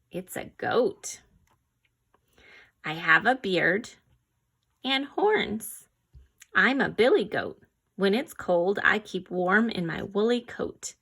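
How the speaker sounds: ordinary talk, nothing marked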